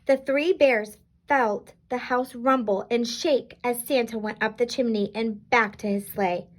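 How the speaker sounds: ordinary delivery, nothing marked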